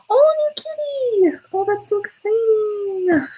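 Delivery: high drawn-out gushing; excited squeal. Real, not acted. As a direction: squeal over the new kitty. Reacting to viewer's new kitty.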